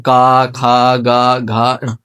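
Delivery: "का, खा, गा, घा, णा" drawn out from the throat; throat sounds. Shown as a demonstration. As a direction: sound out "का, खा, गा, घा, णा" at the back of the mouth. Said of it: "बिल्कुल गले से निकल रहे हैं".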